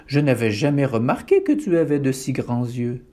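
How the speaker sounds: usual speaking voice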